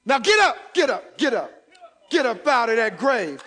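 loud rousing get up; shouted command. Acted. Preacher voicing God, shouting at the congregation to rise. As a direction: voice God ordering them up.

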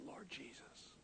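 voiceless, very soft murmur; hushed retold prayer. Preacher praying alone in the car, hushed whisper. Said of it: "I wept. I said".

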